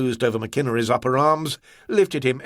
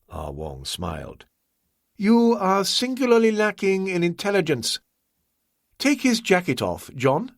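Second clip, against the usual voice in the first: higher, courteous voice of the host; Ah Wong, polite host; 9 semitones higher than usual. A narrator's reading. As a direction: play the composed, obliging host, pitched up.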